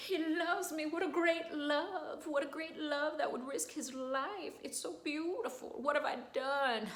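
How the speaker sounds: high, slow, dreamy soprano voice; swooning Adina. Acted. Adina learning his sacrifice, high swooning voice. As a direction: melt at how beautiful it is. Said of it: "Adina's like".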